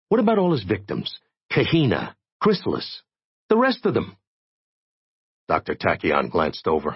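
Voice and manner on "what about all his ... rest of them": rising, pitch climbing high in outrage; indignant protest. Acted. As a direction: push the question up higher and higher. Jay protests about the victims.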